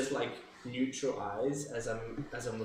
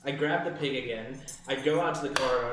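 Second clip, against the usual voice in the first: ordinary delivery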